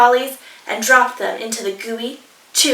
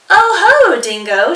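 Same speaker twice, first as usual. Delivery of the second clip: much higher, louder fluttery hello; fluttery emu; 7 semitones higher, 9 dB louder than usual. Acted. Emu greeting dingo, much higher and louder. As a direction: sweep in grandly, all flutter.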